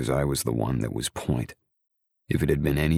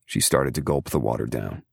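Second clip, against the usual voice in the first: usual speaking voice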